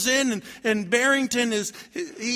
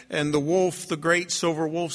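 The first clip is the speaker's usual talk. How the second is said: ordinary delivery, nothing marked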